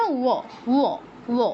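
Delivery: "ও" said as a rounded wo glide; short, separate "ও" syllables with a w onset; demonstrated "ও" sound. Shown as a demonstration. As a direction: say "ও" as wo, each one alone. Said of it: "এটা কীরকম উচ্চারণটা".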